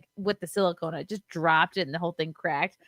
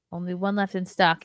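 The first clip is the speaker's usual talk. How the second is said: ordinary delivery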